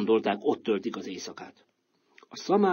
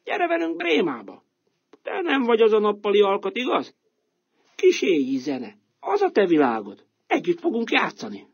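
higher, coaxing and cheerful; donkey inviting; 9 semitones higher, 4 dB louder than usual. Acted. Donkey coaxing the cat to Bremen, cheerful and higher. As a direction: win the cat over to come along.

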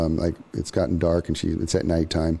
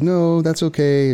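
high, louder firm no in her voice; adamant refusal; 10 semitones higher, 4 dB louder than usual. Retold or imitated. Woman refuses the stranger's help, high and adamant. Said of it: "being adamant".

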